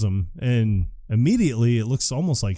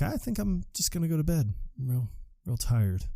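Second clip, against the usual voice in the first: higher, softer mock-weary excuse; subdued excuse; 5 semitones higher, 8 dB quieter than usual. Uneasy guest excusing himself to go to bed, subdued. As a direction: beg off in a small, soft voice.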